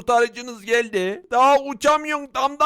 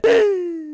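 startled gasp; 6 semitones higher than usual. Acted. Villager startled by the magician.

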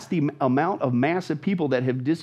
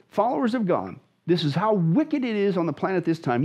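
normal talking voice

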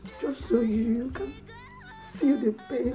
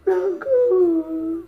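high, loud, drawn-out command; anguished dismissal; tearful shout; 9 semitones higher, 9 dB louder than usual. Acted. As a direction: scream at the husband to leave, crying. Wife throws husband out.

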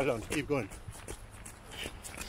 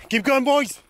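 loud, high yell of encouragement; high rallying shout; 12 semitones higher, 6 dB louder, slower than usual. Real, not acted. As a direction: coach shouting at weary marchers. Shouting to marchers.

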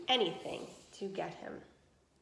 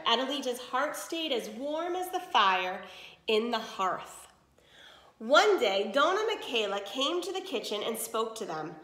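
usual speaking voice

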